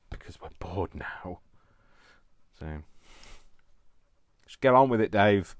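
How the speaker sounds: acted impatient shout; impatient urging. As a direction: call out as a fed-up audience. Voices impatient viewers urging them on.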